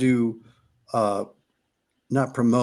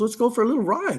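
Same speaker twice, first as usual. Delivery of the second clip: a light, higher-pitched invite; casual invite; 10 semitones higher, faster than usual. Retold or imitated. Tom inviting his nervous friend to ride, breezy higher invite. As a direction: toss it off breezy and up high. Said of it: "Tom goes".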